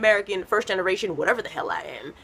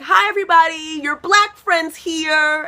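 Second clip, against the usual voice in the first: high, loud, slow showy announcement; big announcing greeting; 9 semitones higher, 7 dB louder, slower than usual. Retold or imitated. Comic retelling her arrival at the house, loud showy greeting. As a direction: make a grand entrance.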